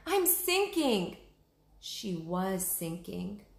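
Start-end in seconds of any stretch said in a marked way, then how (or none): 0.1-1.2 scared Annie. Acted. Annie sinking in mud.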